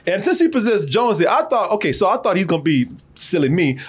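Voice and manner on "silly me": ordinary delivery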